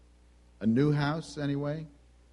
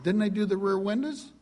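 retold upset question, pitched up high; higher, indignant customer's protest, lightly acted; mildly upset; 6 semitones higher than usual. Customer on the phone about the bill, mildly upset. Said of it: "I start to get upset".

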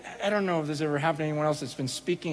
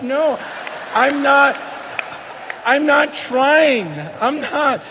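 louder and higher, pleading not guilty; loud protest; 8 semitones higher, 8 dB louder, slower than usual. Retold or imitated. Preacher protesting to laughing students, loud and insistent. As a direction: insist you're not trying to be funny.

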